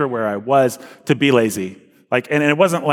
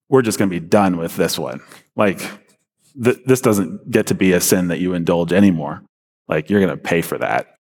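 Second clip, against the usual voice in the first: voice of God; 5 semitones lower than usual. Retold or imitated. God speaking to him.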